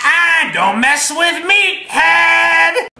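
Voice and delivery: slow, high drawn-out yell; slow high battle cry; catchphrase shout. Acted. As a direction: bellow the catchphrase.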